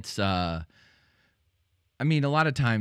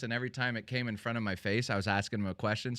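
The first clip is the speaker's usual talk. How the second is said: usual speaking voice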